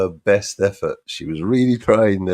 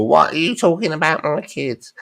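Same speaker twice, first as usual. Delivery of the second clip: higher mock outraged squawk; mock outraged woman; 8 semitones higher than usual. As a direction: parody her indignant tantrum. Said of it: "she'll throw a shit fit and go".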